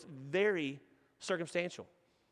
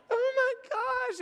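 anguished; much slower than usual. Retold or imitated. Dad overwhelmed by son's words.

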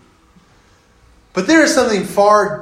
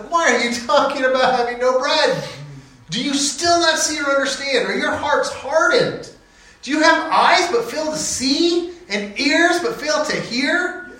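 exasperated Jesus; 7 semitones higher than usual. Acted. Jesus rebuking the disciples.

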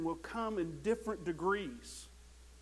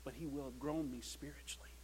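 soft intimate whisper; 4 semitones lower, 6 dB quieter, faster than usual. Acted. Pastor confiding his own growth, whispered. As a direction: breathe it out softly. Hushed personal confession.

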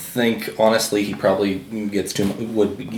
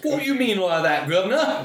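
a hint of a British accent, pitched much higher; mock British accent; 9 semitones higher than usual. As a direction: tease in a light British accent, laughing. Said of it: "can't do a British accent".

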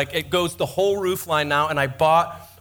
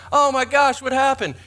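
mock dismay; 7 semitones higher, 4 dB louder than usual. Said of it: "you're like".

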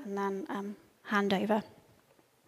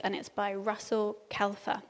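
normal talking voice

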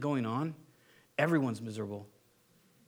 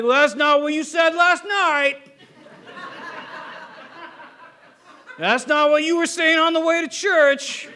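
higher, louder, slow nagging wife with a laugh; mocking wife; 9 semitones higher, 7 dB louder than usual. Wife contradicting husband's prophecy in church, loud mock scorn. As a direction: lay into him like a scornful wife. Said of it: "you'd be like".

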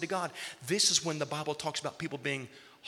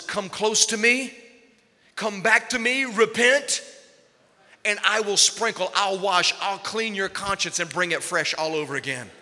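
higher, louder pleading call; voice of God pleading; 6 semitones higher, 7 dB louder than usual. Acted. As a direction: call them home, rising and urgent. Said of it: "God says".

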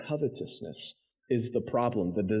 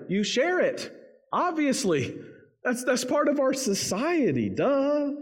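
much higher, incredulous "obviously, duh"; mock duh voice; 12 semitones higher than usual. Preacher voices Jesus' hearers with a much higher, scoffing duh. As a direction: play the whole crowd scoffing at an obvious question. Hearers answering the rich man.

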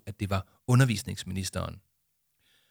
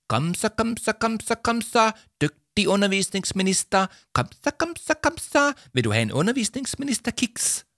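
very high coaxing puppy-talk; dog-training voice; 12 semitones higher than usual. Acted. Jama luring the minister like a dog, high coaxing. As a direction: coax her like a dog with a treat. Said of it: "sagde Jama med en høj stemme".

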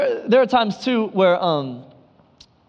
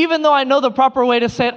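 ordinary delivery

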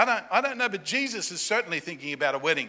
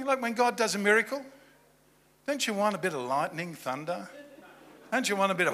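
ordinary talk, nothing marked

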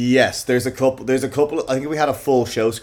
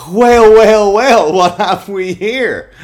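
loud mock surprise; 7 semitones higher, 10 dB louder than usual. Pun on "well well well.

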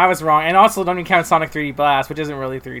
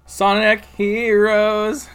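excited; 6 semitones higher, 5 dB louder, much slower than usual. Real, not acted. Enthusiastic vote call.

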